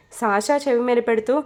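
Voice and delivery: plain reading voice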